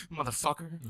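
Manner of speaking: imitating a trembling curse; quiet wobbly mock curse; shaky voice. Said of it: "in a really shaky voice goes".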